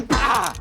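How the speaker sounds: sharp, high pained cry; sudden hurt outcry. Acted. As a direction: yelp as the pipe hits your hand. Hit by a pipe.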